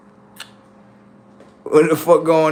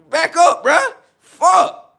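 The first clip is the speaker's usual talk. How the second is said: high yelp; 4 dB louder, slower than usual. Real, not acted. Fans crowding him in game.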